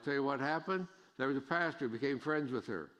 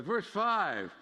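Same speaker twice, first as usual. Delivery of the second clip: louder, higher preaching, slowing on the key lines; full-force preaching; 9 semitones higher, 6 dB louder, slower than usual. Acted. Pastor urging the congregation to accept outsiders, preaching hard. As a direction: drive the point home from the pulpit.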